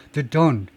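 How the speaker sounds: "the don't" said as "thuh don't", first vowel barely there; weak THAT before don't. Shown as a demonstration. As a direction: reduce the first word of "the don't" to a schwa. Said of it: "하면 리스닝이 되겠어요?". ("the don't"를 '드 돈'처럼 뭉개서)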